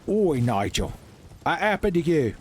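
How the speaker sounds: lightly put-on British accent; a clumsy British bloke calling out; bad British accent. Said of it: "worst U K impression".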